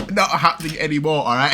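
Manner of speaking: giving up with a laugh. Real, not acted. Laughing at herself mid-sentence.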